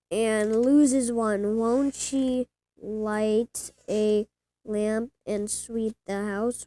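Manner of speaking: slow careful read-aloud of a Bible passage; reading scripture aloud. Acted. Grandchild reading the lost coin story to grandpa, slow and careful. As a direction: read it out word by word like a young reader.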